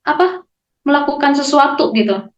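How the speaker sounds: ordinary talk, nothing marked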